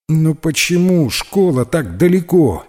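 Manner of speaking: high-pitched schoolboy whine; whiny, put-upon complaint; whining.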